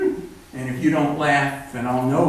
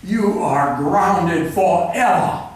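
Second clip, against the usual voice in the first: slow, higher, louder bellowed decree; father's drunken shout; 5 semitones higher, 7 dB louder, slower than usual. A narrator's reading. Drunken father shouting at teenage son, slow furious shout. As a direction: thunder it down at the boy, slowly. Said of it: "his father shouting at his teenage brother".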